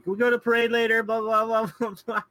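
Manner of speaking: put-on high, loud, whiny kid yelling from the window; yelling bratty kid. Kid yelling down to his dad from the upstairs window, high and loud. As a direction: shout it down like an annoying kid upstairs.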